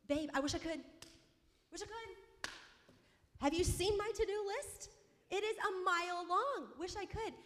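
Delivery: high, worked-up retort to a partner; exasperated refusal. Retold or imitated. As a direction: turn them down in a high, overwrought voice. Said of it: "I literally got mad at Brock".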